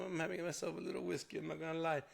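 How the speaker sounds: normal talking voice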